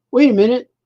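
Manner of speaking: loud, high startled outburst; sudden surprise. Shown as a demonstration. Reading his comic aloud, the hero suddenly startled. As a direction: blurt it out, suddenly realising. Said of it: "gonna read this to you now".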